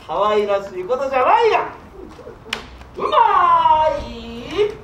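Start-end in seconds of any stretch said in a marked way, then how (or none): 3.0-4.7 drawn-out savoring. Acted. Savouring the sake.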